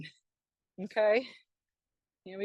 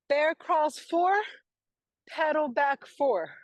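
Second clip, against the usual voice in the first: calling out; 6 semitones higher, 4 dB louder, slower than usual. Real, not acted. Coach calling next exercise.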